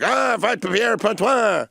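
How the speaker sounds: put-on slow, high French Canadian accent. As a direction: drawl it out like a Quebec hockey goon. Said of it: "Get some French Canadian in there and be like".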